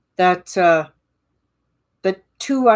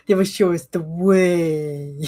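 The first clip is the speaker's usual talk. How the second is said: mystical oracle; 3 dB louder, slower than usual. Pretend. Mystical prophecy about Plutons.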